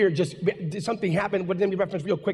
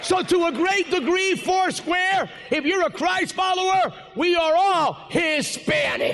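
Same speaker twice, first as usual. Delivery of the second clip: full-force preaching; 8 semitones higher, slower than usual. Real, not acted. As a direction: preach it out to the congregation.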